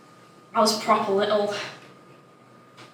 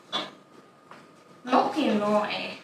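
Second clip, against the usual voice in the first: ordinary delivery, nothing marked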